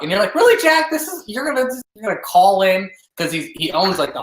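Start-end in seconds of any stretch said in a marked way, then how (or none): 0.3-2.9 high-pitched, incredulous mock protest; mock exasperation. Imitating the player answering Jack's unwanted call, exasperated. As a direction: push it up high, playing put-upon disbelief.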